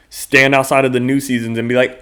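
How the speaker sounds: normal talking voice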